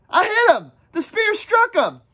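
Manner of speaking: put-on high, loud boyish boast; proud excited cry. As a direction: crow over the hit. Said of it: "full of fright and apprehension and pride".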